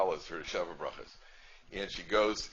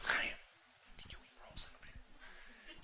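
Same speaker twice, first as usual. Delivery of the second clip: furious whispered hiss, much softer; silent yell; 11 dB quieter, slower than usual. Retold or imitated. Wife hissing at husband over the rolls, furious whisper. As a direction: yell at him without making a sound. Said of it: "women have this ability to yell without making a sound".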